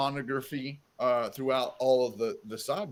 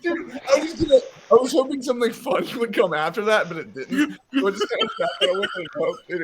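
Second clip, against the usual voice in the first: high and louder, speeding up into a laugh; embarrassed laughing; 10 semitones higher, 6 dB louder than usual. Real, not acted. Admitting the joke fell flat to friends, embarrassed laughing. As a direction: laugh off the awkward silence.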